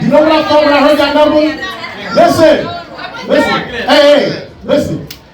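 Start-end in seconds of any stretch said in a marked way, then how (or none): none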